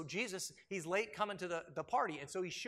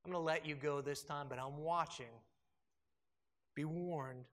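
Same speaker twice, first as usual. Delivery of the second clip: quiet, menacing mock warning; mock contempt; 5 semitones lower, 4 dB quieter than usual. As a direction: parody a condescending judge. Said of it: "He also doesn't go and say".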